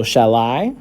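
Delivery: "shall I" with I drawn out and rising; stress on I. Shown as a demonstration. Teacher showing the stressed syllable, stress on I. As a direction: say "shall I", stretching the I upward. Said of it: "the I is a little bit more drawn out".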